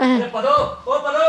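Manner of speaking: high call across the house. Acted. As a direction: shout for the servant, pitch rising.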